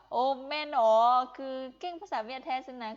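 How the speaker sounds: loud "oh really?" opening, easing off; surprised. Retold or imitated. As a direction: play a listener who just found out. Retells listeners' amazed reaction.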